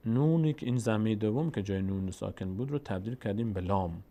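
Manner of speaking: ordinary talk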